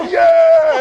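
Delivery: high loud yeah shouted; drunken whoop. Retold or imitated. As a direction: whoop it to the room. Drunk friends cheering.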